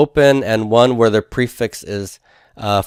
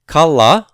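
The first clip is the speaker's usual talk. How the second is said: Kala, KA punched higher and louder; raised, emphatic KA in Kala; stress on first syllable; 6 semitones higher, 6 dB louder than usual. Shown as a demonstration. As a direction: hit the KA of Kala hard. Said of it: "keep that stress on the root one letter".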